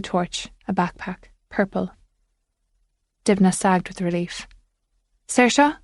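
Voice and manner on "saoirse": calling out. Acted. Calling into dark building.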